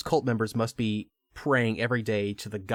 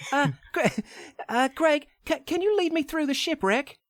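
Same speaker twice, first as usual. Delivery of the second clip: much higher pretend Lara voice, British accent. Redoing Lara asking Greg for help, much higher British voice. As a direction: squeak up into a girlish British Lara. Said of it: "I don't know why that's a dude".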